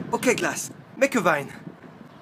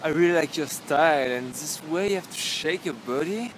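ordinary talk, nothing marked

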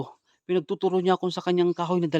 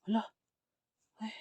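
ordinary talk, nothing marked

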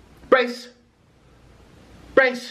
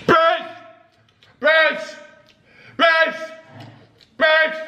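shouting brace; 6 semitones higher, 3 dB louder, slower than usual. Acted. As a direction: call out brace, high and loud. Shouting commands in game.

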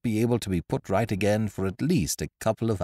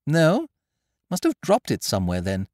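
slightly higher casual toff; lightly voiced young gentleman; 8 semitones higher, 5 dB louder than usual. A narrator's reading. Wooster dismissing the lost case, lightly voiced. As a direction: shrug it off.

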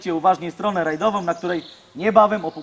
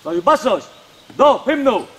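drill sergeant; 4 semitones higher, 4 dB louder than usual. Real, not acted. Commands before the anthem.

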